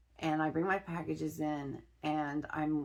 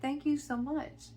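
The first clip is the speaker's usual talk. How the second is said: much higher, grateful gush; retold grateful thanks; 9 semitones higher than usual. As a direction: replay your thanks to a kind stranger. Retells thanking the kind stranger.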